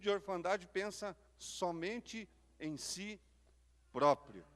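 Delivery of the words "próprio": "próprio" punched louder and lower; punchy emphasis. Shown as a demonstration. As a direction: land hard on "próprio". Pregador enfatiza palavra final. ("próprio" com força e grave)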